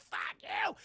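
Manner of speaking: voiceless mock curse; hostile plant whisper. Plants curse the family, hostile whisper. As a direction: whisper it like howling wind.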